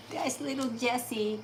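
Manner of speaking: soft, slow cooing at the dog. Real, not acted. Owner coos softly to her little dog.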